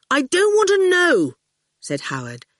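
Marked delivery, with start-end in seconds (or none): 0.1-1.3 put-on boy's voice, higher and a bit louder; cheeky boy brushing off a giant; young boy. A narrator's reading. Howard answering the towering goon, boyish and higher.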